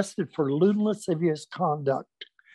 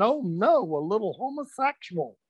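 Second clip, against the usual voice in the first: mock scream; 5 semitones higher than usual. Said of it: "God jumped back screaming".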